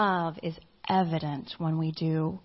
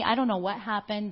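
ordinary talk, nothing marked

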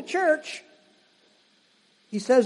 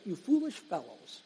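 quoting roommate; 3 semitones lower, 13 dB quieter than usual. Retold or imitated. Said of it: "He always would say that".

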